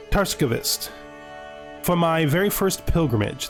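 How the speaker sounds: reflective, theatrical narration; in-character journal reading. Acted. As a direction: read it like a mage recalling a pilgrimage. Reads game character's journal aloud.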